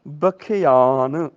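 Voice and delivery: ਬਖਿਆਨ stretched very slowly, syllable by syllable; ਬਖਿਆਨ spelled out slowly; slow pronunciation. Shown as a demonstration. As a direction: draw out ਬਖਿਆਨ so the ਇਆ is clear. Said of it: "ਇਹਦਾ ਉਚਾਰਨ ਹੋਏਗਾ".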